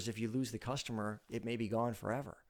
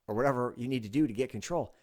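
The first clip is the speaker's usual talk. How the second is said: usual speaking voice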